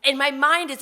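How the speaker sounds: ordinary talk, nothing marked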